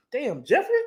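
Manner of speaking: high-pitched mock surprise; surprised. Imagining a hard dude with a London accent hitting him, surprised. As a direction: act taken aback, voice jumping up into a question. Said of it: "I'm gonna be surprised".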